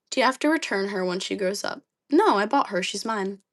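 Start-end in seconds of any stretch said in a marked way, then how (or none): none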